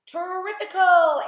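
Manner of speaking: loud, high, stretched-out cheer; exultant cry. A narrator's reading. Aunt rejoices over the peach, exultant cry. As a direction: draw it out in a big high shout. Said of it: "Aunt Sponge cried out".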